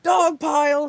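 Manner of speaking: excited mock shout. A narrator's reading. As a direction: call it out like a battle cry. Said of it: "shouted Hockey".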